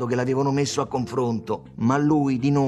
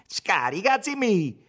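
high-pitched, acted command; mock-stern order, amusement held in; giving an order; 8 semitones higher, slower than usual. A narrator's reading. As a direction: order your pal off, holding back a laugh. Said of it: "ordinò Tommaso, con la bocca che gli si ingrinziva".

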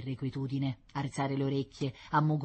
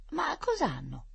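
put-on curious little girl; 13 semitones higher, slower than usual.

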